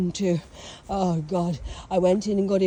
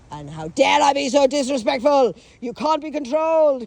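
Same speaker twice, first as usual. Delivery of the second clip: dad's outrage; 5 semitones higher, 8 dB louder than usual. Retold or imitated. Dad scolding, voiced loudly.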